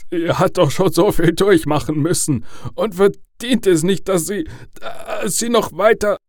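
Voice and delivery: tearful, high-pitched plea, halting; voice breaking. A narrator's reading. Father pleads for his sick daughter, voice breaking. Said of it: "Seine Stimme brach".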